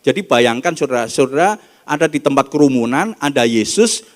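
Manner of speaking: loud, ringing sermon voice; full-force preaching. Acted. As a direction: preach the passage out to the whole hall.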